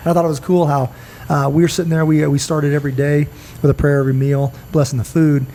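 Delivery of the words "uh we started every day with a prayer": ordinary talk, nothing marked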